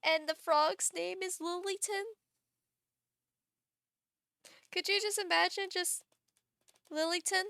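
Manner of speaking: high giggly voice, amused at the idea; tickled, high-pitched musing. Real, not acted. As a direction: say it high, barely over the laughter. Giggling over frog idea.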